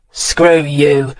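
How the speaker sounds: ordinary delivery, nothing marked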